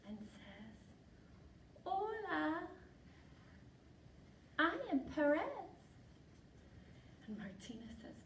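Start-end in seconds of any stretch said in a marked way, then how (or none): 1.8-5.4 high-pitched little mouse voice; high, careful mouse greeting. Acted. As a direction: introduce yourself as a tiny mouse, pitched up. Little mouse introduces himself.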